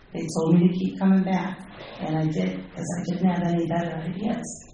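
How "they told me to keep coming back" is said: usual speaking voice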